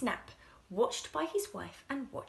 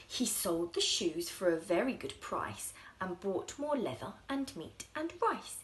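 usual speaking voice

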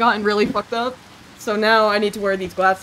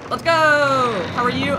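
loud, high drawn-out cheer; big raised-pitch yell; hyped shout; 5 semitones higher, 7 dB louder than usual. Real, not acted. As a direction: holler it out, pumped.

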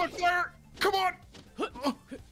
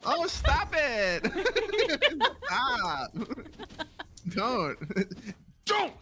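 high, slow giggling protest; laughing protest; 8 semitones higher, much slower than usual. Real, not acted. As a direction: squeal through laughter.

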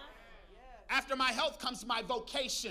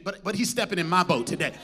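loud declaration; 4 semitones lower, 11 dB louder than usual. Real, not acted. Tell your neighbor, preached loudly.

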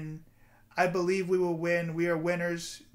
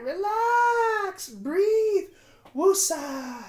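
high, loud, crying through the words; 7 dB louder, slower than usual. Real, not acted. Vlogger reassuring himself on camera, crying. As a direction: sob out a reassurance to yourself.

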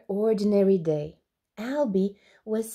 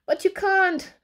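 high-pitched anxious protest; worried squeal; panicked; 10 semitones higher, 3 dB louder than usual. Acted. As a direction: plead in a panic. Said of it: "panicked Alby".

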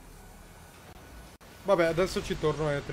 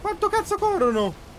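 exasperated outburst; 14 semitones higher, 4 dB louder than usual. Real, not acted. Enemies chasing him.

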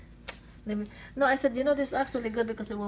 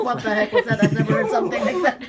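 voicing the pet, louder, through laughter; laughing pet quote; 2 semitones higher, 8 dB louder than usual. Owner voices the startled pet while laughing, louder. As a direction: laugh out the pet's shock. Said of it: "Squeaks was like".

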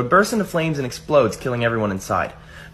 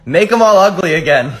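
higher, louder joke payoff; exclaimed punchline; 6 semitones higher, 6 dB louder than usual. Acted. Last man in line tells God his wish, loud exclamation. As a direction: call out the wish with force.

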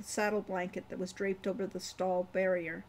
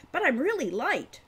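insistent; 7 semitones higher, 6 dB louder than usual. A narrator's reading. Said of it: "Wren insisted".

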